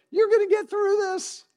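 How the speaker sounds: "You're gonna get through this" sung out high and bright; encouraging promise. Shown as a demonstration. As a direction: lift them up with confident hope. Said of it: "I could look at some of you and say".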